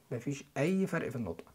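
ordinary talk

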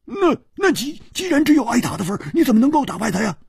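high and very fast; stammering rush. A narrator's reading. Hu Tiehua pressing Chu, high rapid stammer. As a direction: tumble out the question in a hurry. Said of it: "胡铁花说".

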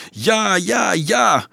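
high chanted crowd reply; massed roar. A narrator's reading. Children back Dolf with one voice, massed roar. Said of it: "dreunden ze massaal".

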